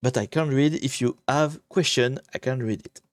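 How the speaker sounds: slightly halting English with a French accent; real French accent, careful English. Real, not acted. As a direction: explain in simple English as a native French speaker. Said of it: "avec mon accent de merde".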